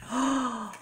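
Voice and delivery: a high sharp gasp of surprise; real startled gasp. Real, not acted. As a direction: catch your breath at the sight. Sees the card, gasps.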